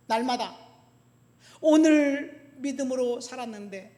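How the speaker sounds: loud, high preacher's delivery; forceful preaching. Real, not acted. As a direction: drive it home to the congregation.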